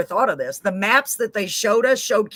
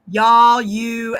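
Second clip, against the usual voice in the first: loud y'all; 7 dB louder than usual. Shown as a demonstration. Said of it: "I say".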